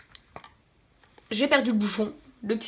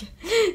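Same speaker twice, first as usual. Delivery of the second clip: breathy giggle; 9 semitones higher than usual. Real, not acted. Laughs at own lisp.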